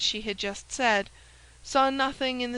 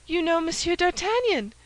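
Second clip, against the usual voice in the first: higher and louder, eager exclamation; excited cry; 7 semitones higher, 4 dB louder than usual. Acted. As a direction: burst out in eager surprise. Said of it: "cried the novice".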